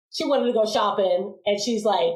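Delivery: ordinary delivery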